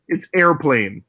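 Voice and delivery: airplane drawn out slowly in two beats; slow, insistent "air-plane", no middle syllable. Shown as a demonstration. Correcting the British friends on airplane, slow and insistent. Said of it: "There's no O in the word".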